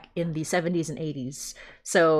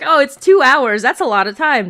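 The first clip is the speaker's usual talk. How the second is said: loud, higher mock whine about the length; mock complaint; 6 semitones higher, 11 dB louder than usual. Host mocking listeners who think two hours is long, loud mock whine. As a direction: put on a scoffing voice for the doubters.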